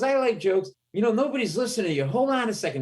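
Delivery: high-pitched, hurried put-on voice; imitated drunk heckler. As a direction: play the drunk taking charge. Drunk man takes comic's side.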